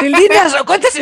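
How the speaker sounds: mock starstruck shout of the name, laughing; excited shout. Echoing the starstruck cleaners.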